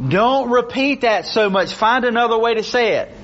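mock teacher's note, high and insistent; English teacher grading. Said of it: "in my English class grading a paper".

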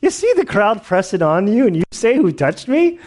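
high, lightly mocking retold voice; scoffing disciples. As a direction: needle him about the obvious crowd. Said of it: "his disciples said to him".

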